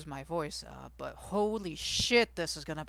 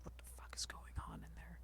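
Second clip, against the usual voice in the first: whisper; 16 dB quieter, faster than usual. Retold or imitated. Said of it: "I'm like".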